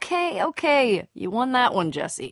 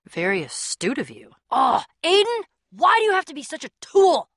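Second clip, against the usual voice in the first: ordinary talk, nothing marked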